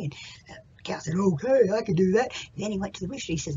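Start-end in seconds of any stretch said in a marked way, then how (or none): 1.1-2.3 a hint of a cow voice; cow character. Acts out the cow replying.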